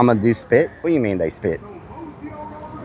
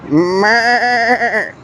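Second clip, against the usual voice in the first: high nasal-free bleating meh; goat bleat; 7 semitones higher than usual. Retold or imitated. Teasing the goats with a bleat.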